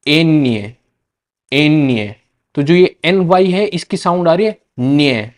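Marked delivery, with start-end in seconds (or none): 0.1-2.2 एन्य as Spanish "eñe" with ny; Spanish letter name. Shown as a demonstration. Teacher to students, Spanish ñ. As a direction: model एन्य clearly. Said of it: "इसको क्या कहते हैं".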